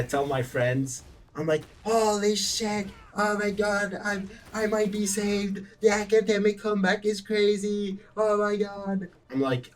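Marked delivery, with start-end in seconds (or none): 1.8-9.1 hammed-up awe, pitched high and stretched out; amazed relief. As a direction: gush to friends in a raised voice. Acting out relief to friends.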